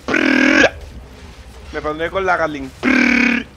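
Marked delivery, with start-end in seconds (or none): 0.1-0.7 very low rolled prrra burst; deep gun rattle. Retold or imitated. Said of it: "lo de prrra". 2.8-3.4 very low, loud rolled prrra; deep gun rattle. Retold or imitated. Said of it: "lo de prrra".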